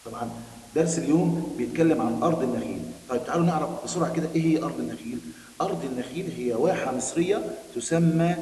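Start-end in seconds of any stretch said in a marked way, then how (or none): none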